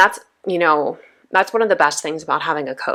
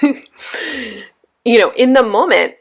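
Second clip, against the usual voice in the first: laughing; 6 semitones higher, 4 dB louder, much slower than usual. Real, not acted.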